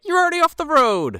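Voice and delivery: very high, loud protest; hammed-up high-pitched comeback; mock exasperated retort. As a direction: imitate a driver yelling back, voice shooting up. Acted retort to the irate man.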